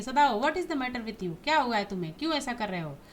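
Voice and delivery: ordinary talk, nothing marked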